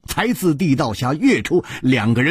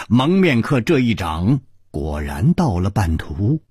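ordinary delivery, nothing marked

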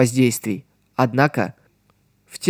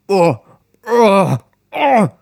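rising strangled choking cries; 6 semitones higher, 3 dB louder, much slower than usual. Acted. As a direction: cry out, choking on water. Drowning man cries out. (сдавленные крики утопающего)

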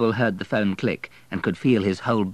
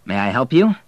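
authoritative banker; 3 semitones higher, 5 dB louder than usual. A narrator's reading. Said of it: "said an authoritative voice".

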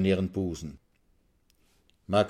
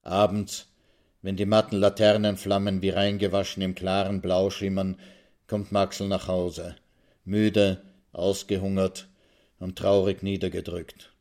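usual speaking voice

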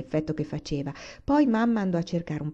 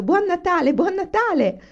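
high, loud cheering; joyful shouting; 12 semitones higher, 7 dB louder than usual. A narrator's reading. Laura and Carrie greet Papa, high gleeful shout. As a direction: shout it high, bursting with glee.

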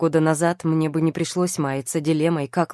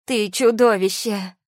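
loud, raised accusation; crying out; 5 semitones higher, 5 dB louder than usual. A narrator's reading. Тия recoils from her husband, crying out in outrage. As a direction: throw the accusation at him. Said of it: "выкрикнула она".

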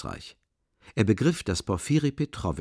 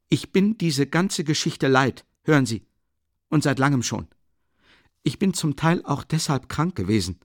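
more irritated; 6 semitones higher, 4 dB louder than usual. A narrator's reading. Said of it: "und wurde noch gereizter".

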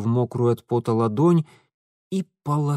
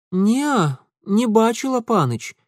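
put-on high girlish voice, shaking her head; timid girl saying no; young village girl; 9 semitones higher than usual. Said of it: "покачала головой девчонка".

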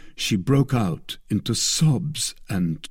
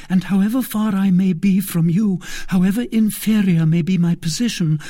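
put-on high, earnest young woman voice; devoted young maid; 9 semitones higher, 4 dB louder than usual. A narrator's reading. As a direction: pledge loyalty with fervour.

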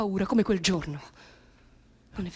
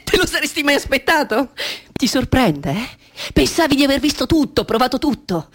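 high, loud, triumphant teasing; exultant taunt; 8 semitones higher, 9 dB louder than usual. Acted. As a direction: crow it at them. (provocazione esultante, alta e forte)

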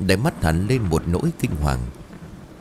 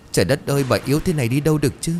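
higher and fast, a touch of crying; mild tearful outburst; 7 semitones higher, much faster than usual. Acted. Lan fearing for her missing mother-in-law, mild tearful rush. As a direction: blurt it out, close to tears. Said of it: "Lan liền òa khóc".

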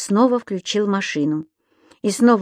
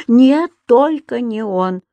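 slow, emphatic denial; heartfelt protest; fervent assurance; 3 semitones higher, 4 dB louder, slower than usual. A narrator's reading. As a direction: insist warmly he is innocent, stretching the no. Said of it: "стал горячо уверять хозяйку".

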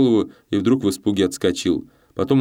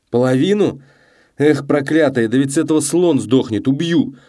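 alarmed, furious outburst, louder and higher; angry outburst; 7 semitones higher, 5 dB louder than usual. A narrator's reading. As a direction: explode in anger and threaten her. Gambler rages at woman over overdose. (гневный, испуганный выкрик)

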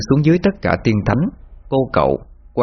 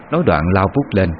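ordinary delivery

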